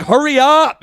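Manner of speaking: loud, high-pitched imitation of a bossy server; impatient shop woman. Donut shop woman to a dithering customer, impatient. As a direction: mimic her rushing the customer along. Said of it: "couldn't order fast enough".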